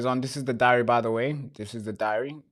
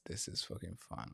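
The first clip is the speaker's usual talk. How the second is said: a bitter whisper, far softer; whispered sarcasm; 6 semitones lower, 20 dB quieter than usual. Real, not acted. Streamer whispering sarcastically as the stream freezes. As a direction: hiss it sarcastically under your breath.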